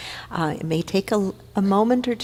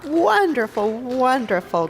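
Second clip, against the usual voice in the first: high, gushing praise, easing down with each repeat; delighted admiration; 9 semitones higher than usual. Real, not acted. Minister presenting prayer partners to congregation, delighted admiration. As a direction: show off the group with real delight. Admiring the group after applause.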